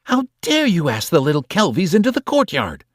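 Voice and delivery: high, icy outraged aunt; furious aunt scolding the child; cold fury. A narrator's reading. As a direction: freeze them with outrage. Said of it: "her cold, furious voice".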